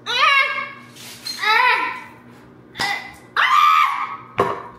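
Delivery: high loud straining screams; frustrated screams. Real, not acted. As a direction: yell with effort while twisting the lid. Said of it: "I'm getting mad".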